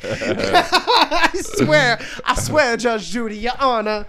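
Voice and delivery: laughing mock plea, high; pleading. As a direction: beg up high through laughs. Pleading bit replayed, laughing.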